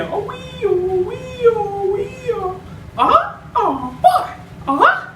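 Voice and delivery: very high cartoon mouse with a giggly uh-huh; Mickey Mouse voice. Shown as a demonstration. Tutor showing viewers the Mickey laugh. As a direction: squeak it out with a chuckle. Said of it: "And they keep repeating it".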